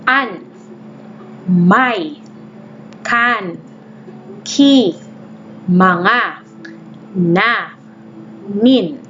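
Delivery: slow, one word at a time; teacher repeating the list; repeated modelled reading. Shown as a demonstration. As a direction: say each word clearly for copying. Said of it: "Ulitin ko".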